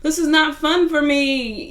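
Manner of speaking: high, insistent retold plea; imitating a pleading girlfriend. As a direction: play the desperate girlfriend begging him to leave. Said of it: "she was like".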